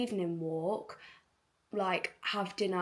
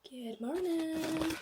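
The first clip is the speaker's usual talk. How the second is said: drawn-out greeting; 8 semitones higher, much slower than usual. Acted. Vlog greeting, slow and high.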